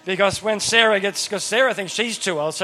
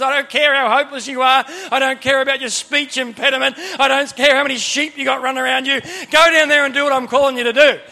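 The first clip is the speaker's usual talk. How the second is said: raised, insistent retelling of God's order; emphatic mock command; 5 semitones higher, 6 dB louder than usual. God ordering reluctant Moses to go, insistent acted command. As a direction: override every excuse, building louder.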